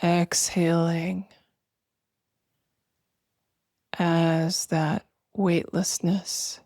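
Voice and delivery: slow, spacious meditation voice with long pauses; guided meditation. Acted. Host guiding listeners to feel breath and weightless arms, slow meditation voice. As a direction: guide them gently through each breath, drawing words out.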